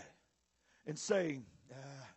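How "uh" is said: very low, hushed granddad murmur; quietly judge it as granddad, pausing after the first sound; granddaddy appraising. Retold or imitated. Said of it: "my granddaddy looking at it".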